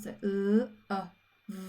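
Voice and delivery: l, uh, v, each phoneme apart; love broken into l, uh, v; sounding out. Shown as a demonstration. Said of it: "split digraph for the uh sound".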